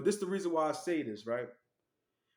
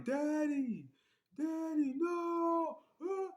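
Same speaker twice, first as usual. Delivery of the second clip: imitating crying kids; 11 semitones higher, much slower than usual. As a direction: call out for daddy like a scared child. Kids crying for their father.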